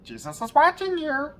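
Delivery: high, loud squawking parrot voice. Retold or imitated. Burglar hears the parrot squawk its warning, high squawk. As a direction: screech it like a talking parrot. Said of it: "the parrot squawks again".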